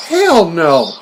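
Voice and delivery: high, incredulous retort; mock indignant refusal. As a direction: refuse as if insulted, pitch leaping up. Acted indignant refusal in movie quote.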